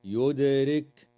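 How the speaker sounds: يدرك said slowly with the د echoing; qalqala on dal. Shown as a demonstration. Teacher isolating the word يدرك to show qalqala. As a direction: bounce the د in يدرك. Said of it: "تقلقل".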